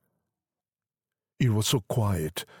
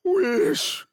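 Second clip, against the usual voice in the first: high-pitched woman's voice, insistent; wife's urging; 4 dB louder than usual. A narrator's reading. Wife urging her husband to wish, high and insistent. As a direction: urge him in a high, raised voice. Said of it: "repeated his wife".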